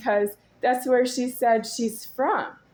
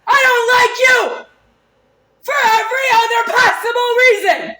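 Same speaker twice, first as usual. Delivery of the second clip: high, loud enraged yelling; furious shouting; 8 semitones higher, 6 dB louder than usual. Real, not acted. Shouting at the man who accused her, enraged. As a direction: scream every line at him.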